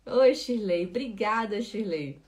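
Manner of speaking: ordinary talk, nothing marked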